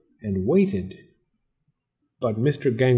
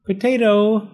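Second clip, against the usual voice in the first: high, loud call; storybook call, pitched way up; calling from hallway; 10 semitones higher, 5 dB louder than usual. A narrator's reading. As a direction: call to the dog from outside. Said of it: "said from the hallway".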